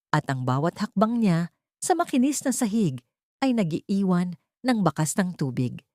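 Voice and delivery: plain reading voice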